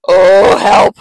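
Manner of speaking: loud, high cry for help; terrified mouse. Acted. Mouse sees a real Gruffalo, loud frightened shriek. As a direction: shriek in alarm at a monster.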